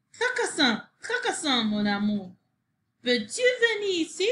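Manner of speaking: high calling voice; woman calling her love from the porch. A narrator's reading. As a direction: call out sweetly across the yard. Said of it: "Appela la femme".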